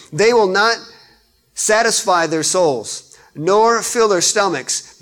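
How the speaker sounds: loud, rising pulpit delivery; forceful preacher's cadence; preaching at full force. Acted. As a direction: build it up like a sermon climax.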